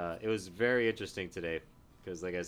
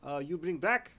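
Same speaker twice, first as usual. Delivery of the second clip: higher, slow mock Serbian accent in broken English; 8 semitones higher, 3 dB louder, slower than usual. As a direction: imitate the Serbian coworker asking for his wrench back. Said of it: "he goes".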